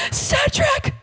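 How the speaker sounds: very high, loud crying shout; hysterical pleading. Retold or imitated. Angela grabbing Cedric, high hysterical pleading shout. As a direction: beg him, wailing, near tears. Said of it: "grabs Cedric by the shoulders and goes".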